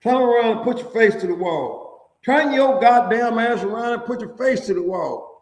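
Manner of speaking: high, loud barked commands; mock guard shouting. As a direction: yell the order like a guard.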